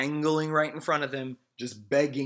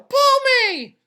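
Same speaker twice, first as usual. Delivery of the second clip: tiny, high, loud tempting voice; pigtail voice; 14 dB louder than usual. A narrator's reading. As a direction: lure him like a little devil. Pigtail keeps tempting Paul.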